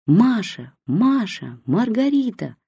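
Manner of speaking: mother calling her lost daughter, rising to Маргарита; a mild call across the cemetery. A narrator's reading. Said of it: "кричала мама на всё кладбище". (мама зовёт потерявшуюся дочь, негромко)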